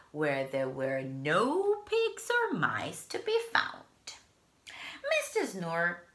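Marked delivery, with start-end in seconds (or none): none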